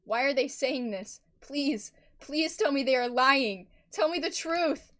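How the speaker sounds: frightened urgent begging; desperate plea. Acted. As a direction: beg for the truth. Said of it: "her voice shook".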